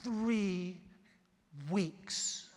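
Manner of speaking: low, soft, with a long pause between the words; serious hush. Retold or imitated. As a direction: deliver it like a grave secret. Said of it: "she got real serious".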